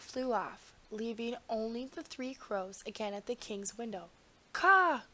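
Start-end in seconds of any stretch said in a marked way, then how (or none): 4.5-5.0 one high, loud caw; loud crow caw. A narrator's reading. A crow cawing at the window, high and loud.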